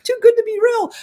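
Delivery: high-pitched imitated astonishment; incredulous. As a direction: react like it can't possibly be true. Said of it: "you're just like".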